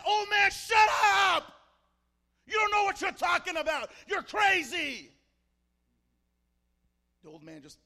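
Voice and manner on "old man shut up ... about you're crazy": full-force high, angry scream. Acted. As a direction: scream him down across the carriage. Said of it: "she screams at the old man".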